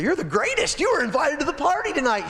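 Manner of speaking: high, gushing praise; flattering wife. Acted. Wife gushes over Haman, high and flattering. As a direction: puff up his ego.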